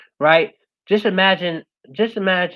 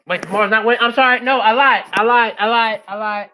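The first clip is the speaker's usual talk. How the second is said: high, loud, hurried backtracking; flustered self-correction; 7 semitones higher, 6 dB louder than usual. Real, not acted. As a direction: blurt out the correction over yourself.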